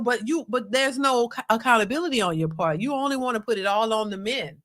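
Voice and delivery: usual speaking voice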